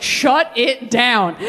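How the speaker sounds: slow, drawn-out mock command; final decree. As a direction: declare it over like a solemn verdict. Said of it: "I was like".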